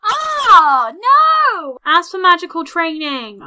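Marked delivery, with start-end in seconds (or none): 0.1-1.8 loud, high cry of dismay; wailing in defeat. Real, not acted. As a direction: wail it long and loud. Beaten again.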